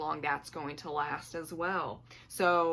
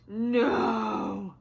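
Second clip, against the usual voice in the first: loud, sudden dismayed "No!"; startled cry; 4 semitones higher, 9 dB louder than usual. Real, not acted. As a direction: yelp it out as something spills. Spills drink, cries out.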